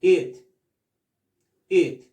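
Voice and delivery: "It" firm and short, vowel between i and e. Shown as a demonstration. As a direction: drill "It" with a very short i, pausing between repeats. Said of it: "Es una I corta, muy corta".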